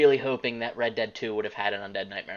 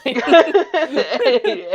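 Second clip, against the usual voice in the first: loud high laugh; 14 semitones higher, 9 dB louder than usual. Real, not acted. Laughing at his own slip.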